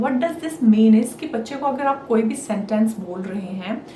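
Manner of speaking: usual speaking voice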